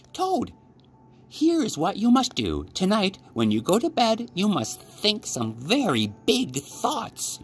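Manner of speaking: high, eager storybook frog; a clever frog with a plan, bright and high; Frog's voice. Acted.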